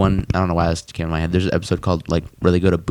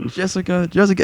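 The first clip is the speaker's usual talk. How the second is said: Morty voice; 8 semitones higher, 4 dB quieter than usual. Retold or imitated. Retelling Morty's voice.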